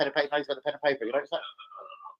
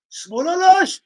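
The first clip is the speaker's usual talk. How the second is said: put-on loud, high-pitched Turkish accent; Turkish kebab worker; 9 dB louder, much slower than usual. Kebab man questions the customer's order, loud mock Turkish accent. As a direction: bellow it as a caricature Turkish server. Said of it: "I've walked in, I was like".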